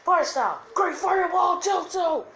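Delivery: high, loud anime attack shout; battle cry. Acted. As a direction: yell the jutsu name with full force. Same move now acted as attack.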